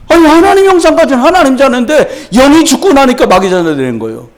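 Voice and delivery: impassioned preaching. Real, not acted. Preacher exposing the devil's work to the congregation, forceful preaching. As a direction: hammer out each charge with a high pitch.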